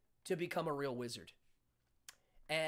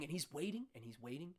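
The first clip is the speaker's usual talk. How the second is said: ordinary talk, nothing marked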